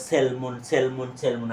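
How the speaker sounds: "সেলমন" with l sounded, sel-mon; common mispronunciation. Shown as a demonstration. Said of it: "তোমরা সবসময় এটাকে বলো".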